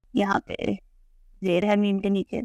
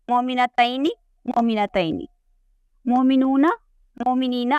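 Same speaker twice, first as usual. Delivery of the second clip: teacher drilling the forms aloud, rising on some endings, falling on others; chart recitation. Shown as a demonstration. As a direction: recite each form clearly, one by one, for the class to repeat. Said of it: "आप भी मेरे साथ repeat करें". (कक्षा को दोहराने के लिए हर रूप साफ़ साफ़ बोलना)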